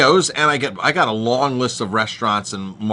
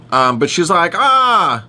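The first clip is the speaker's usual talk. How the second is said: normal talking voice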